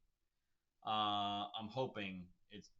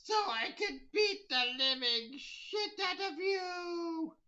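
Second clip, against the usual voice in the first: high, slow, mocking sneer; slow, high mock-sinister payoff; menacing kid; 6 dB louder, slower than usual. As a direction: drag it out like a gleeful threat. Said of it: "he's like".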